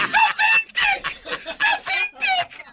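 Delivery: loud, slow, very high shriek; hysterical scolding. Retold or imitated. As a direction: imitate a host screaming it through laughter. Host's outburst, retold while laughing.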